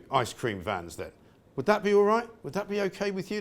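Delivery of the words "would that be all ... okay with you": needling sarcastic question aimed at the council. Acted. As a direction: ask the council with mock politeness. Mocking the council's ban.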